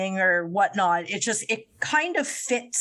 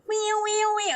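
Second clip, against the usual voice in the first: mock high wee; 11 semitones higher, faster than usual. Imitating the singer again.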